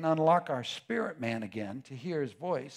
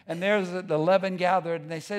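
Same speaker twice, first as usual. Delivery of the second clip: ordinary talk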